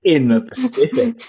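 whispered Or is Polynesia in the Pacific? with a chuckle; laughing whisper. Pretend. Host sneaking the answer to the audience, whispered with a laugh. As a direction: slip them the answer in a giggly whisper.

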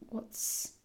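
whispered to herself; muttering under breath, searching memory. Real, not acted. Whispering to herself while trying to remember a saying.

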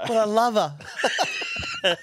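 slow, high, laughing through the words; laughing gush. Acted. Friend gushing about her, laughing and slow. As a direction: gush fondly while cracking up.

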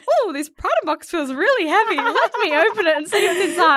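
playful wondering, close to laughing; mock-innocent curiosity. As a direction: lightly act out an unsuspecting discovery. Acts out innocently opening the box.

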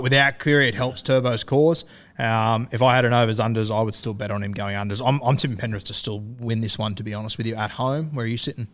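ordinary talk, nothing marked

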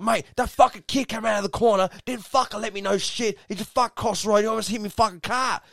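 high-pitched, agitated complaint; acted-out worked-up grievance; imitated angry rant. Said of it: "you're, you're angry".

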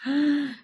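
a sharp, delighted intake of breath; excited gasp. Real, not acted. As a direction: gasp with joy at the question. Delighted reaction to food question.

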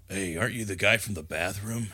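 low, soft suspicious question; a hint of doubt; lightly voiced Loomis. Loomis quietly asking the deacon about the bathroom, soft.

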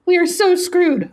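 very high-pitched acted worry; mock dread. Reader voicing friends caught gossiping, high mock dread. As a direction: pitch it right up in comic alarm. Acting two-person exchange from comic.

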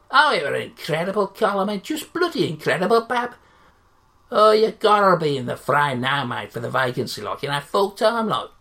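loud, brash local radio reporter; loud cheery Yorkshire radio interviewer; sports interviewer. Acted. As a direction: gush at the manager like a matey sports hack. Interviewer hypes the manager.

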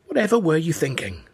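high, hushed reproach; angry hiss. A narrator's reading. Mother rebuking foolish father, high hissed scolding. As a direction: scold under the breath in a high voice. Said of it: "she hissed".

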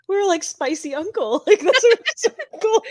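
high-pitched, talking through laughter; can barely get it out for laughing. Real, not acted. Friend retelling a shared uncle joke, laughing as she talks. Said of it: "I'm laughing 'cause my friend and I".

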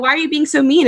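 hurt question. Retold or imitated. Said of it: "Or like".

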